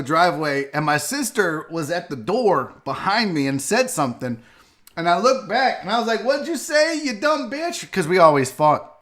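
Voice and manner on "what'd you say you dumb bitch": retold sibling argument. Host retelling an argument with his sister, mock challenge. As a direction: toss back a retold insult. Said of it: "I'm arguing with her".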